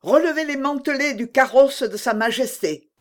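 high-pitched command to a servant; called-out order. A narrator's reading. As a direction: call the order across to him. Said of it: "cria d'Artagnan".

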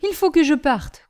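upset and emphatic; agitated resolve. A narrator's reading. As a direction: break off the discussion in distress. Upset nephew announces he must leave.

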